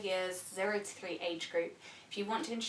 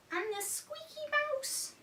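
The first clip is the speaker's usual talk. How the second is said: squeaky mouse voice; slower than usual. Shown as a demonstration. Mouse voice for toddlers.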